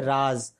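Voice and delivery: "राज" pronounced "raaz"; nukta z sound. Shown as a demonstration. Teacher explaining the nukta's effect, "राज" said with final z. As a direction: end "राज" on a buzzing z. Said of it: "इसका उच्चारण हो जाता है".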